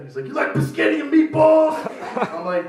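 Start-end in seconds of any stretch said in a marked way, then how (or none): 0.2-1.8 much higher, louder mock stranger voice; high pushy stranger. Stranger pushing biscotti on him, high loud voice. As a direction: ham up an eager weirdo.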